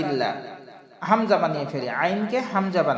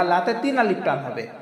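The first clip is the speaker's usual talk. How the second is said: ordinary delivery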